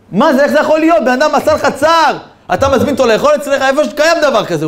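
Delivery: very high, strained shouting; sustained high-pitched outburst of disbelief; incredulous tirade. Retold or imitated. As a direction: act out an overwhelmed man demanding answers. Acted tearful confrontation with forgiving host.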